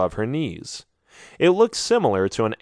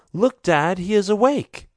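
put-on higher, lighter girl's voice; a young woman calling to her dad; young woman's voice; 9 semitones higher than usual. A narrator's reading. Daughter excitedly telling her dad he is awake. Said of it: "he hears a female voice say".

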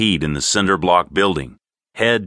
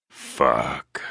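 low, soft, sighed curse; hushed, resigned exhale; 7 semitones lower, 6 dB quieter than usual. A narrator's reading. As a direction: sigh the word out quietly. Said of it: "said it softly with a sigh".

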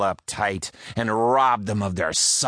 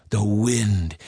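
ordinary delivery

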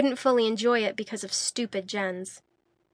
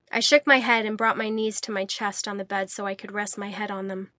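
ordinary delivery, nothing marked

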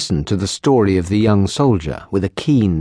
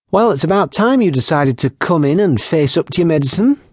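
a higher, quick, lightly scolding barmaid; gentle scolding; 7 semitones higher, faster than usual. Acted. Barmaid gently scolding her wounded patient, higher and brisk. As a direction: tease him into taking his medicine, briskly. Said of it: "Kylie gently scolded".

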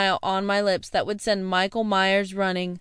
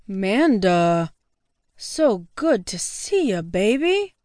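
put-on lower, slow, slurring drunk charm; smooth-talking tipsy boyfriend; drunk guy voice; 4 semitones lower, slower than usual. Drunk Alex greets Manda.

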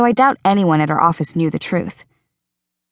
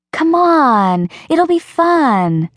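put-on higher, slow coaxing girl voice; Rachel pleading; 9 semitones higher, 3 dB louder, much slower than usual. Rachel begging her friend to come, slow and sing-song. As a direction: draw out it'll be fun to win her over.

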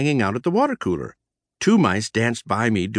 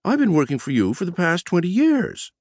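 high, annoyed squeaky voice; annoyed squeak; 8 semitones higher than usual. Acted. Editor annoyed the boss forgot her, high squeak. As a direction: squeak out your offence. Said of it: "she squeaked, sounding annoyed".

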